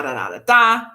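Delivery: usual speaking voice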